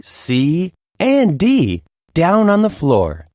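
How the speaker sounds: usual speaking voice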